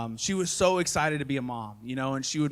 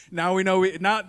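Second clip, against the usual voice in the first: ordinary delivery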